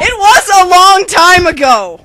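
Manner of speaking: loud, high comic yell; mock-epic shout. Co-narrator finishing the intro, shouting. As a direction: bellow it like a trailer voice. Shouted punchline of parody opening.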